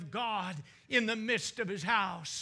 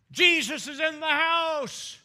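loud, high proclamation; noised abroad; 8 semitones higher, 9 dB louder than usual. Retold or imitated. Preacher voicing the news spreading through town, loud proclamation. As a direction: burst out with the news for all to hear. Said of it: "it was noised abroad".

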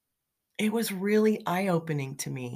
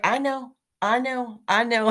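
higher, eager "I know" from an imitated cashier; mock cashier agreeing; 7 semitones higher, 4 dB louder than usual. Checkout woman echoing her customer's rant, higher mock voice. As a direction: chime in like a sympathetic checkout lady.

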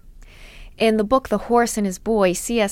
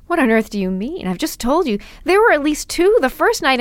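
put-on indignant boy; 6 semitones higher, 4 dB louder, faster than usual. A narrator's reading. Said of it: "said Shasta".

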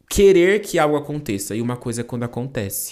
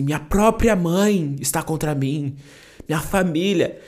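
slow, higher-pitched wounded protest; dramatic betrayal; 4 semitones higher, 4 dB louder, slower than usual. Retold or imitated. Son feeling his own mother is against him, dramatically wounded. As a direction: play up the hurt, drawing it out.

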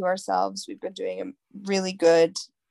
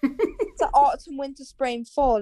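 put-on Love Island accent; 5 semitones higher than usual. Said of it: "It's the Love Island accent".

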